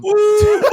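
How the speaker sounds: a squealing ooh that tips into laughter; delighted high-pitched ooh and laugh; high whooping laugh. Real, not acted. Teasing about getting paid.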